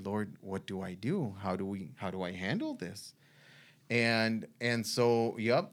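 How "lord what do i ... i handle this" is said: hushed prayer. Retold or imitated. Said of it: "I started praying".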